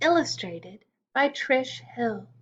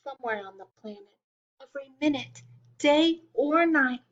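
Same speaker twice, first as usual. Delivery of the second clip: put-on reading a poem to children. A narrator's reading.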